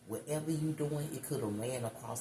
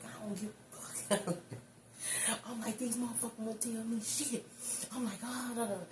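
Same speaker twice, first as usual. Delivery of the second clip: high, exasperated retold comeback; retold worked-up protest; 11 semitones higher than usual. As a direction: replay the indignant protest up high. Quotes her worked-up reply to dad.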